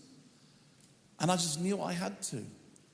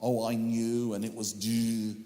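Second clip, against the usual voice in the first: mock boastful; 6 semitones lower, 4 dB louder than usual. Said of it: "I'd love to tell the story like".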